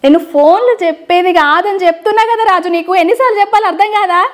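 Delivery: high, exasperated prank-call scolding; put-on anger. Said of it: "నేను fire మీద మాట్లాడినా".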